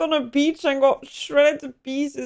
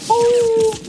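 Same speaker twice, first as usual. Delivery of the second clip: startled oh; 10 semitones higher, 7 dB louder than usual. Real, not acted. Reacting to war film scene.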